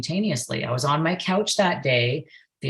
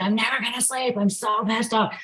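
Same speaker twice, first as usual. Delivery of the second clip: retold anger; 8 semitones higher than usual. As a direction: re-enact being fed up, voice pitched way up. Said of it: "I was so freaking angry".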